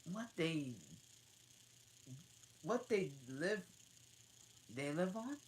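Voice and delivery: eager to know; curious Alice. Acted.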